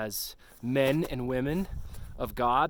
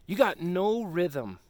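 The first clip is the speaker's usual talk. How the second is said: usual speaking voice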